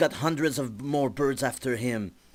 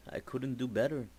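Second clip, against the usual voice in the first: softer, mumbled apology; sheepish; 6 dB quieter than usual. Acted. E-Man apologizes to Jazz, soft and sheepish. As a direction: admit it quietly, a bit embarrassed.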